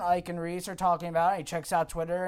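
normal talking voice

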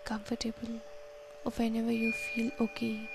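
soft, unhurried counting and calming guidance; guided meditation. Acted. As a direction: talk them gently through each breath. Leads a breathing meditation.

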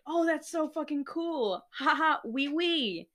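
high, sing-song fake delight; mock delight. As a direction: play it sweetly thrilled. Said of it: "and so I was like".

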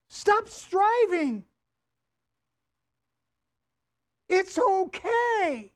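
high, slow, gentle pleading reassurance; reassuring. Acted. Preacher soothing a worried congregation, gentle and slow.

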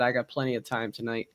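usual speaking voice

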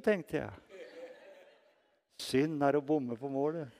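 ordinary delivery

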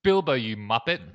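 loud, hammed-up Kiwi jibe; bad New Zealand accent. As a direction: badly imitate a New Zealander. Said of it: "the terrible New Zealand thing".

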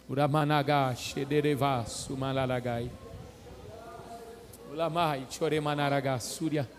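soft, low murmured tongues; speaking in tongues. Real, not acted. Fala em línguas, suave. (falando em línguas, baixo e suave)

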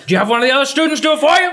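hammed-up accusing yell; mock yelling Dumbledore. Dumbledore grilling Harry in the movie, hammed-up yell. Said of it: "he's yelling at people".

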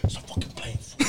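hushed voiceless mock whisper; retold whisper. At the baptism, friend scolds him for the prank, whispering.

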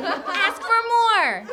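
high, slow taunting command; alien cry. A narrator's reading. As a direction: draw it out as a high little alien. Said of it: "cried one".

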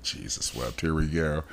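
quiet exasperated mutter; weary muttered aside. Real, not acted. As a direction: sigh it out under your breath.

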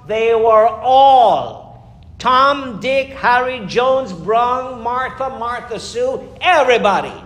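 loud, drawn-out roll call of names; slow and loud on "all", then fast through the names; rising preacher shout. Real, not acted. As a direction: call out every name to the back pew. Preacher calls out the whole roll.

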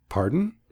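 Pardon with PAR stressed, rising at the end. Shown as a demonstration. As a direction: lean on the first syllable of Pardon. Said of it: "with the emphasis on the first syllable".

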